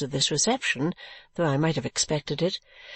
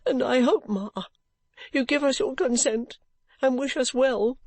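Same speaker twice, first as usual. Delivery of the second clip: tearful pleading; 5 semitones higher than usual. Acted. Caddy pleading.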